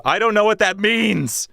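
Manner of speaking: high, hammed-up yell; mock outburst. Streamer answers a chat comment, mock high yell.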